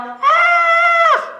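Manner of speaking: high piercing scream. Acted. Megan screams as the bugs land on her, piercing scream. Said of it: "Megan yelled".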